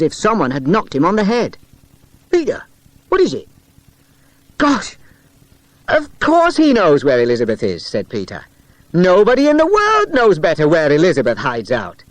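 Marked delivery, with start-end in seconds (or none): 2.3-3.5 alarmed. Acted. Friends alarmed at Peter. 4.6-12.0 realisation. Acted. Sudden realisation.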